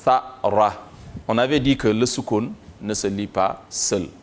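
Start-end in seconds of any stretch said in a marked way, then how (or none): 0.0-0.7 slow, drawn-out chanted Arabic recitation; Quranic recitation. Shown as a demonstration. Teacher chants a verse for students, slow recitation. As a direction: recite each word slowly for the students.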